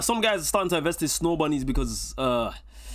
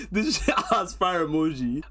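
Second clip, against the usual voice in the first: ordinary talk, nothing marked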